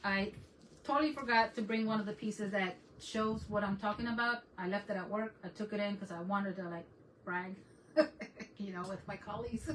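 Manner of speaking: ordinary talk, nothing marked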